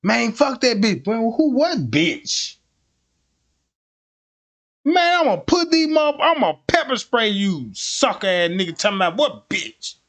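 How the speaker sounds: high, loud mock cop yelling at a suspect; angry cop. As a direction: threaten him with pepper spray, shouting. Cop arguing with boyfriend.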